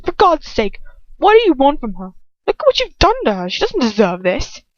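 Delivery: high, loud, furious pleading; angry outburst. A narrator's reading. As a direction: shout him down in outrage. Ron confronts Lucius angrily.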